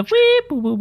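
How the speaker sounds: high-pitched whooping noises; imitated cartoon babble. Podcaster trying to show co-hosts how a character sounds, high imitated whooping. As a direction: mimic an odd computerized voice with high whoops. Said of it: "Like, it's hard to explain".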